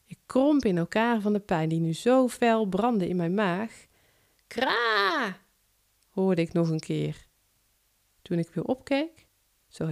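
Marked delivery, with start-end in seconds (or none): 4.5-5.4 high, harsh cawing like a crow; one sharp crow's caw. Acted. Narrator voicing the crow outside the train window, high caw.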